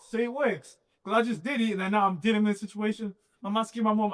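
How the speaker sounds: rushing high and loud with excitement; telling it all in a buzz of excitement; excited. Real, not acted. Excited retelling, much higher, louder.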